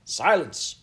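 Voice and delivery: loud, high royal command; queen's roar. A narrator's reading. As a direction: roar for order. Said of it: "roared the Queen".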